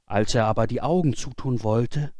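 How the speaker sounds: plain reading voice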